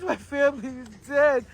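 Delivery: put-on gloomy moan, falling at the end; mock mournful. Shown as a demonstration. Mocking how they are supposed to walk around, mock mournful. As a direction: mope it out as a parody of grief. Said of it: "I'm supposed to walk around like this?".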